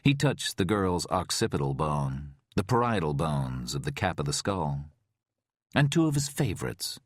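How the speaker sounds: ordinary delivery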